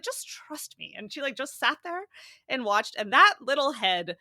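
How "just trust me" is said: high, hushed Irish voice; a confident hushed Irish aside; Irish nurse impression. Mother quoting the Irish lactation consultant, hushed Irish voice. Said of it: "she was like".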